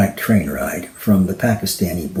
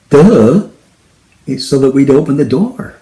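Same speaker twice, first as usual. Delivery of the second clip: sarcastic duh; 4 semitones higher, 5 dB louder than usual. Acted. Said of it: "Daisy couldn't help it".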